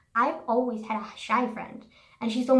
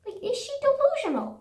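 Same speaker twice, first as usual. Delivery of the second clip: the same question, much higher, in disbelief; incredulous squeal; 13 semitones higher, 3 dB louder than usual. Real, not acted. Venting about the rude waitress, high incredulous squeal. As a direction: shoot the pitch up on delusional.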